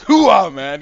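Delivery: loud exultant cheer; triumphant whoop. Acted. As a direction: whoop like you just scored a hit. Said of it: "just goes".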